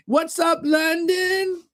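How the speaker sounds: very high-pitched imitation of a friendly American greeter; grocery store woman. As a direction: call out a high, sing-out hello. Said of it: "the woman would say".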